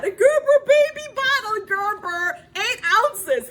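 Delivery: loud, high eager cry; emphatic excitement. Acted. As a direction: shout the brand with longing. Wishing for small bottle.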